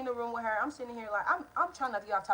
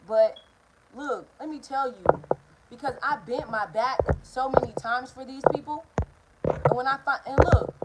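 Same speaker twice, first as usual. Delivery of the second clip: louder, emphatic and insistent; passionate outburst; 4 semitones higher, 8 dB louder than usual. Real, not acted. Telling viewers why she left family, loud and emphatic. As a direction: push it out like you finally need to be heard.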